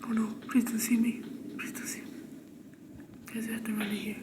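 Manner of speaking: frightened unvoiced whisper. Real, not acted. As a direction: whisper a desperate plea. Hiding from Granny in game.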